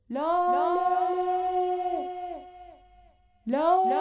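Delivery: long drawn-out high call of Laleh's name; calling out loud. Acted. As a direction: sing out the name across the valley. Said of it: "فریاد زد". (فریاد کشیده و آوازگونه)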